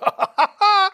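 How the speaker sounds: high, loud jeering laugh; mocking cackle. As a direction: cackle in scorn. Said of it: "Hohngelächter".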